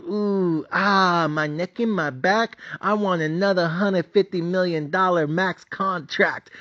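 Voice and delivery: mock whiny player. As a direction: ham up a pampered star groaning on the floor. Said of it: "he's going".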